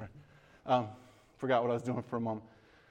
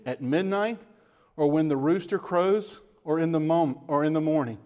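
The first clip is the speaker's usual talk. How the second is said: raised, emphatic Bible reading; scripture reading; 2 semitones higher, 3 dB louder than usual. Acted. Pastor reading Jesus' warning to the church, emphatic. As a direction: read the verses out to the flock.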